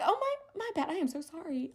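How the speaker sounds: high, soft, embarrassed apology; sheepish apology. Retold or imitated. Caught driving too close, sheepishly high and soft. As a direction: say sorry in a small, high voice.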